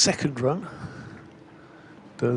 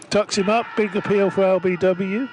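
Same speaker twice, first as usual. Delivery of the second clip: raised, louder burst of commentary; excited live call; 6 semitones higher, 3 dB louder than usual. Real, not acted. Commentator calling a big LBW appeal, excited. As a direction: lift the pitch as the appeal goes up.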